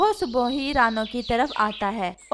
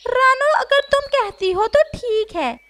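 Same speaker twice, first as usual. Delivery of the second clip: higher voiced parrot, chatty; a lively parrot talking to his friend; higher parrot voice, friendly; 11 semitones higher, 4 dB louder than usual. Parrot agrees reluctantly.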